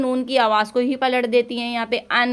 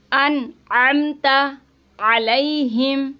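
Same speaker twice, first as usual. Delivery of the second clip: drawn-out tilawat; slow recitation; much slower than usual. Shown as a demonstration. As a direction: recite each word slowly. Quran recitation demo. (धीमी तिलावत)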